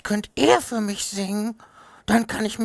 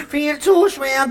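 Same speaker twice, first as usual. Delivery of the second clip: higher, louder panicked cry for help; 5 semitones higher, 7 dB louder, slower than usual. Acted. Bee crashing under the heavy honey bucket, calling out in panic. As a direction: shout for help while falling.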